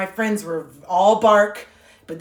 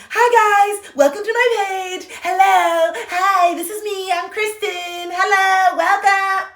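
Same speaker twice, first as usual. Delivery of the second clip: much higher, louder sugary greeting voice; sweet online persona; 11 semitones higher, 6 dB louder, slower than usual. Retold or imitated. Greeting her followers online, fake sugary sweet voice. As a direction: gush like a bubbly influencer. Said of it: "Doctor Jekyll and Hyde shit".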